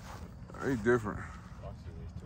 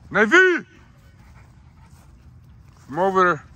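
loud high call to the dogs; 10 semitones higher, 11 dB louder than usual. Real, not acted. Calling dog at night.

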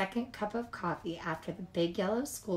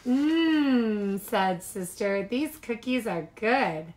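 savoring mmm; 2 semitones higher, 5 dB louder, slower than usual. Acted. Sister tasting cookies.

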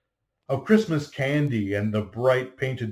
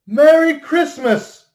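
high, loud, slow cry of Merry Christmas; childlike joy; 13 dB louder, slower than usual. Acted. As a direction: shout it out like a delighted child. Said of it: "as a child says it".

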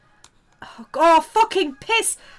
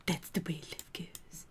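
whispering; 4 semitones lower, 14 dB quieter than usual. Pretend. Whispered song line.

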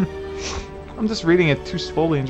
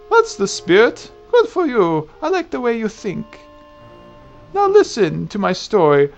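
put-on high, louder approving old woman; old lady voice; 5 semitones higher, 4 dB louder than usual. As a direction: cheer them on like an old crone. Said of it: "I get to do an old lady voice".

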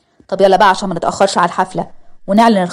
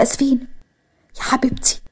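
tearful; 5 semitones higher, 5 dB quieter than usual. Acted. Mother moved by pregnancy news.

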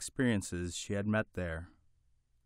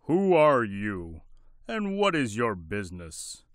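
booming warrior king; 5 dB louder than usual. Acted. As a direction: boom it out like a mighty king. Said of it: "in his big, deep, kingly voice".

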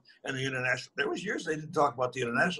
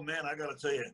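usual speaking voice

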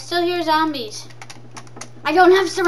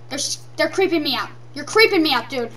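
normal talking voice

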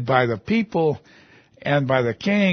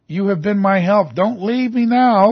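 urgent plea; 6 semitones higher, 5 dB louder than usual. Acted. Voicing the psalmist's plea to God.

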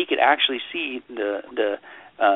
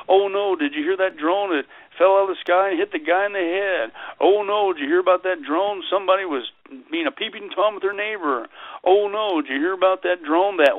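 mock alarm; 6 semitones higher than usual. Parodying alarmist news chatter.

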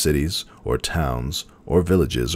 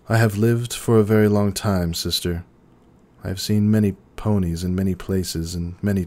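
higher, gentle princess voice; Celestia's voice; 6 semitones higher than usual. A narrator's reading. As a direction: speak as an ancient, weary princess.

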